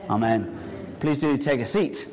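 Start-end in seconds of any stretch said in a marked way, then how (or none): 0.1-0.5 prayer. Acted. Pastor leading the congregation in prayer, reverent low voice. Opening prayer before children's talk.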